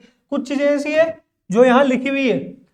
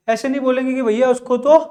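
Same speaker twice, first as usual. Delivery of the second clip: ordinary talk, nothing marked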